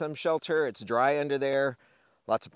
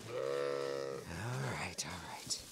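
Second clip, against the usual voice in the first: hushed unvoiced all right; whispered soothing; 8 semitones lower, 11 dB quieter than usual. Real, not acted. Farmer hushing a crowding animal, whispered and gentle.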